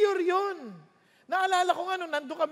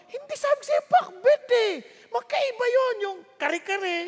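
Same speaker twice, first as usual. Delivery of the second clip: higher insistent correction; complaining husband; 7 semitones higher than usual. Retold or imitated. Husband scolding wife about pakbet, higher and insistent. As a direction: insist on what was ordered. Spouse quarrel over dinner.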